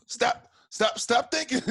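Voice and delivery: high, breathless stop stop stop; near-whispered, high-pitched plea from real emotion; emotional outburst. Real, not acted. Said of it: "I get emotional".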